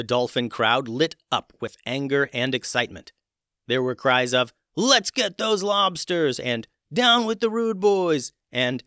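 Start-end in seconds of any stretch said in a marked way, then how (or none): 4.7-6.4 high, slow eager dolphin cry; excited crowd cry. Acted. 6.9-8.3 high, slow eager dolphin cry; excited crowd cry. Acted.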